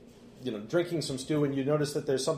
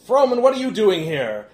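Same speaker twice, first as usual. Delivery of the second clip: raised, loud, startled demand; indignant challenge; 9 semitones higher, 10 dB louder than usual. Acted. Remy challenges Froman across the room, indignant challenge. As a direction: call out sharply across a hall.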